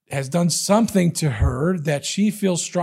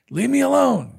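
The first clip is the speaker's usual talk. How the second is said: much higher, pleading widow's cry; 7 semitones higher than usual. Acted. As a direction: plead it up high like a nagging old lady. Widow pestering the judge.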